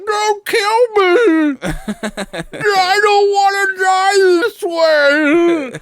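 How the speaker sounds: very high, drawn-out fake crying; hammed-up, stretched-out sobbing plea; mock pleading wail. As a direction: imitate a victim wailing for mercy. Imitates woman begging for her life.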